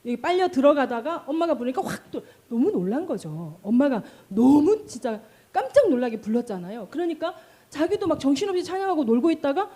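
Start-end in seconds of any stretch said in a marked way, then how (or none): none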